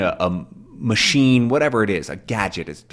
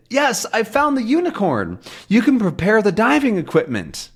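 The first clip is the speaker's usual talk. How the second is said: put-on much higher young hero voice; Tintin replying; 11 semitones higher than usual. Tintin radios that he found the unicorn, higher voice. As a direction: report it brightly and sure. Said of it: "we see Tintin".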